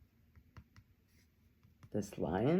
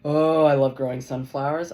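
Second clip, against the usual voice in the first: gushing; 3 semitones higher, 12 dB louder than usual. Real, not acted. Gushing about sunflowers.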